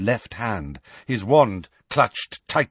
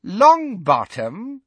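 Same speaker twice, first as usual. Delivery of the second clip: high, slow, mocking rising voice; Bellatrix; 10 semitones higher, 6 dB louder, slower than usual. A narrator's reading. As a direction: savour the name, cruelly amused. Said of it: "repeated Bellatrix".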